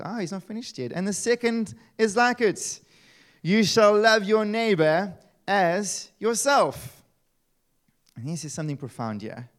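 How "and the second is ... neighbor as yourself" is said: slow, raised reading of the commandment; solemn scripture reading. Acted. Preacher reading the second commandment to his congregation, slow and solemn. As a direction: lift the verse up slowly and firmly.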